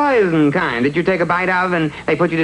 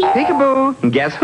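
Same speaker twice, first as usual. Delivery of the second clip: playful peekaboo; 8 semitones higher than usual. Acted. Playful peekaboo to sad wife.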